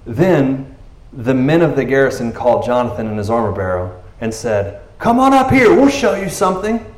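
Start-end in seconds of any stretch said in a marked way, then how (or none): 5.0-6.8 high, louder mock dare; taunting guards. Garrison men calling Jonathan up, high taunt. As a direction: dare them up the hill.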